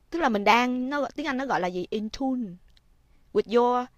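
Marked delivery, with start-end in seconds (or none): none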